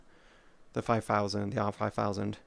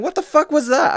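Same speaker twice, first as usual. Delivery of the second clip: high, loud mock yell; mock outraged complaint; 13 semitones higher, 7 dB louder than usual. Opponent raging after a flick shot, hammed-up high yell. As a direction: play the furious victim, up high and loud. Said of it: "people complaining".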